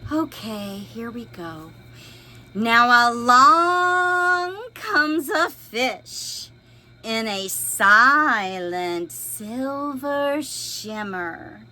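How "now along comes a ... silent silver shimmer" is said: lively storybook read-aloud; expressive picture book reading; reading to children. A narrator's reading. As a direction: read it big for little listeners. Resumes reading.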